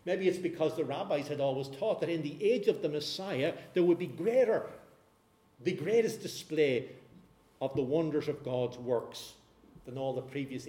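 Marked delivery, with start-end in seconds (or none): none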